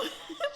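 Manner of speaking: short high giggle; real little high laugh. Real, not acted. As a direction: giggle at your own joke. Laughs at own joke.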